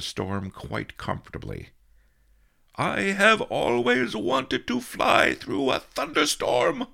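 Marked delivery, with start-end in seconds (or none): 2.8-6.9 high, loud, drawn-out cry of delight; exultant high-pitched old master's shout; happy shout. A narrator's reading. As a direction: shout it out slowly with glee, pitched way up. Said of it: "Master Li shouted happily".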